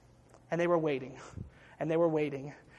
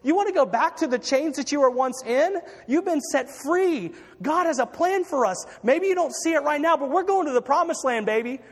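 acted exasperation, pitched well up; rally doubters in high, exasperated preacher's voice; frustrated leader; 7 semitones higher, 3 dB louder than usual. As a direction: play a leader at his wits' end. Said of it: "frustrated as a leader".

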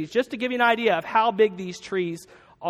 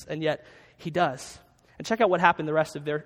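ordinary talk, nothing marked

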